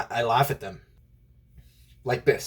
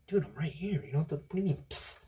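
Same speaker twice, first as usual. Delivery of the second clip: hushed, puzzled comeback; whispered reply; 3 semitones higher, 12 dB quieter than usual. Retold or imitated. Whispered reply to secretive janitor.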